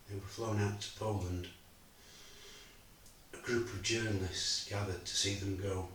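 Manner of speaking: quiet, measured, heavy with loss; a father recalling betrayal and his wife's death; grieving father's monologue. Acted. A father telling the audience of loss, subdued and grieving. Actor performs Bob's final monologue.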